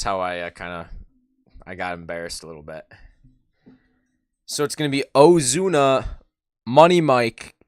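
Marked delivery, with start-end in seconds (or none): 4.4-7.5 higher and louder, pumped up; hyped call. Real, not acted. Fan hyping viewers as the batters come up. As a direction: call the hitters like a hype man.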